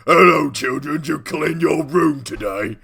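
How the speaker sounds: loud, high, drawn-out goblin nanny; Mary Poppins hobgoblin. Acted. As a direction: holler it at the children like a monster nanny. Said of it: "He's all".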